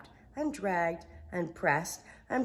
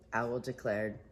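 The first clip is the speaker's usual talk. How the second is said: ordinary delivery, nothing marked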